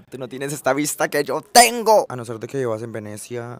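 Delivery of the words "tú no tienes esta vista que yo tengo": louder, higher boast; bragging shout. Real, not acted. To viewers about his window view, loud bragging. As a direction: brag it at full voice. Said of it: "repeated for emphasis".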